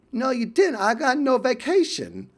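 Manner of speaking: playful Essex impression; mock Essex accent. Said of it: "that awesome English accent".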